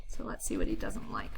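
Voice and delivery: "So let's see what he doesn't like" whispered with no voice; whispered aside. Real, not acted. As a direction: hush it like a secret.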